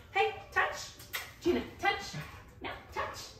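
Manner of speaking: high, bright cue to a dog; commanding a dog. Real, not acted. Cueing dog to touch.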